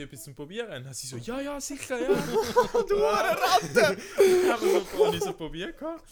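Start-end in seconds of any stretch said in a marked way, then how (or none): 1.2-2.1 mock eager saleswoman, much higher; hammed-up eager yes, much higher and louder. Saleswoman's eager reply retold. 2.1-2.8 high shrieking laugh; real squealing laughter; burst of laughter. Real, not acted. Laughing at the scene. 2.9-6.0 words squeezed out through laughter; real giggling speech, much higher and louder; laughing. Real, not acted. Laughing while retelling.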